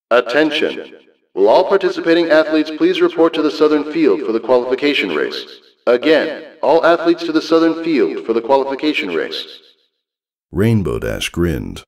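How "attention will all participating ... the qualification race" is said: high, loud announcer call over a PA; crackling stadium PA announcer; loudspeaker announcement. Acted. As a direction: announce it to the whole field.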